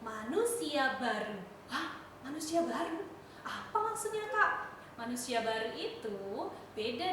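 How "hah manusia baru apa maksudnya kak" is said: put-on puzzled child.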